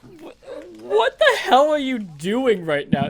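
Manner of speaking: high, loud disbelief; real incredulous outburst. Real, not acted. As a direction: burst out baffled at the silly voice. Baffled by the absurd accent attempt.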